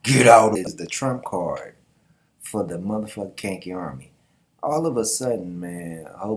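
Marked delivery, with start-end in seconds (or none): 0.0-0.6 loud, forceful battle cry; Zeno roaring as he charges through; booming shout. Acted. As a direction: blast it at the enemy. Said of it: "he's like".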